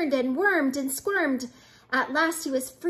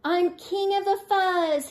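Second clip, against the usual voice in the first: higher-pitched, boastful snarl; 6 semitones higher than usual. A narrator's reading. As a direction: lightly snarl it like a tyrant. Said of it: "he snarled".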